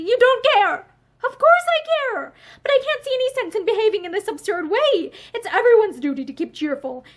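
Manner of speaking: high, loud exasperated protest; heated quarrel. A narrator's reading. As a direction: argue back, exasperated. Husband and wife quarrel.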